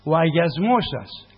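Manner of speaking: loud, slow scripture proclamation; scripture reading. Acted. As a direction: declaim the verse to the congregation. Reads scripture aloud in sermon.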